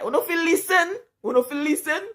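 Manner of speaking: high, mock-angry parental yelling; imitated scolding dad. Acting out dad scolding during beating.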